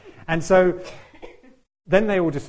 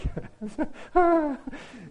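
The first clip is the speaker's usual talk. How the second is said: high delighted laugh. Real, not acted. Laughing at twenty thousand views.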